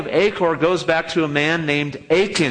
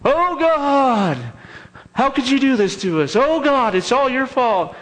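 high, wailing mock lament; mock moaning; 9 semitones higher than usual. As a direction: whine at God like a crowd. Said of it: "everybody's moaning again".

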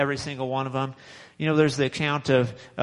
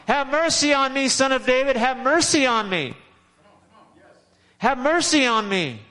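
higher, pleading cry for mercy; retold shout; 8 semitones higher than usual. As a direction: call out like a blind beggar straining to be heard. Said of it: "he starts to shout and shout and shout".

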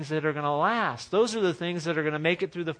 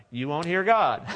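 usual speaking voice

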